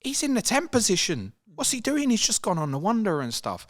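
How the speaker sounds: mock fan in high-pitched disbelief; exasperated fan. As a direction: throw up your hands at the defender. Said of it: "you'd be like".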